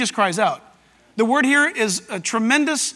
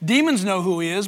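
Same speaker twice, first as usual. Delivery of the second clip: normal talking voice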